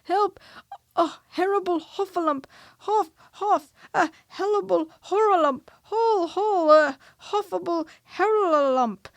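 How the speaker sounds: high, loud panicked yelps, words tumbling; crying out in panic. A narrator's reading. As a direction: scream while running away in terror. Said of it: "still crying out".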